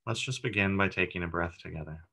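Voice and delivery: even, unhurried meditation voice with long pauses; calm, steady body-scan narration; guided meditation. Acted. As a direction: guide listeners gently through breath and body, leaving space between phrases.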